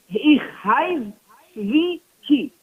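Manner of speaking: slow, drawn-out "Ich heiz zwei ki" in Yiddish; Yiddish phrase. Retold or imitated. Preacher repeats the rabbi's answer, slow Yiddish. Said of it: "He says".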